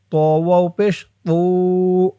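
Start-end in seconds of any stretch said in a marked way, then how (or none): 1.3-2.1 "তু" chanted with a long held u; one-alif stretch. Shown as a demonstration. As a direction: hold the vowel of "তু" steadily. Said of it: "তু এক আলিফ টান". ("তু" এক আলিফ টেনে)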